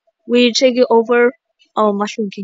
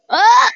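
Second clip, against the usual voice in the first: startled cry; 11 semitones higher, 3 dB louder than usual. Real, not acted. Player startled in game.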